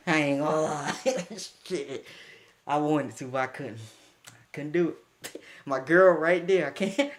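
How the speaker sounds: ordinary talk, nothing marked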